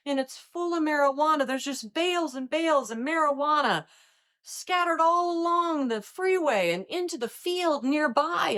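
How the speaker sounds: high, frantic caller voice; a prank caller faking alarm; mock panic from the caller; panicked caller. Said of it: "this panicked driver called me and said".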